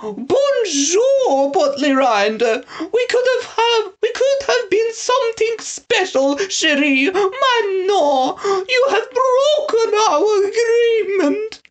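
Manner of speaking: very high falsetto French lady, laughing and mock-weeping; taunting Frou-Frou. A narrator's reading. Snatcher disguised, taunting Lord Portly-Rind in a high mock-tearful voice. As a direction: taunt him in a fake lady's voice. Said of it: "Frou-Frou's voice called down, taunting".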